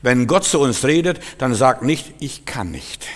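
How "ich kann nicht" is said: very soft, low mock helplessness; feeble, imitated refusal; lame excuse. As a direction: give up in a tiny voice. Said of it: "das sind unsere faulen Ausreden".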